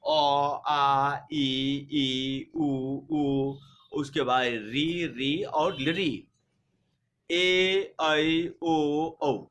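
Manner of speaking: अ, आ, ई, उ said one by one, each vowel drawn out and clear; vowel chart recited for the class; reciting the vowels. Shown as a demonstration. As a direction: spell out each vowel like a teacher, slowly. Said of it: "तो देखिए यहाँ ये स्वर वर्ण के तहत आ जाएगा आपको".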